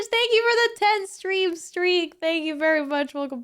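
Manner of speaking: excited thanks. Real, not acted. Thanking a subscriber on stream.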